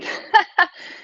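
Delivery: short high breathless laugh. Real, not acted. Laughs at herself, winded.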